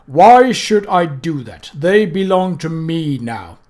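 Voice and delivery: slow, loud, looming doom; slow menacing voice of Death; Death's voice. Acted.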